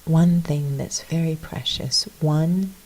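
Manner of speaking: soft, unhurried reflection with long pauses; guided meditation. Real, not acted. Leading listeners into a little reflection, soft meditation voice. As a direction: lead them inward quietly.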